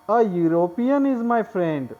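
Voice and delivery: "A European is my friend" read very slowly; slow example reading; slow dictation. Shown as a demonstration. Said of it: "देखिए".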